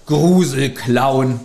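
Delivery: ordinary delivery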